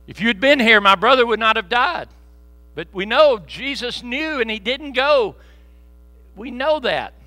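loud, high, fervent revival preaching; preaching at full force. Acted. Pastor retelling Roger's prayer to the congregation, loud and fervent. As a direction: shout the testimony to the church.

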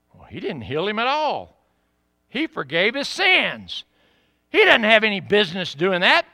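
mock outrage with big pitch swoops; indignant skeptics. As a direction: imitate scandalized objectors. Skeptics object indignantly to forgiveness.

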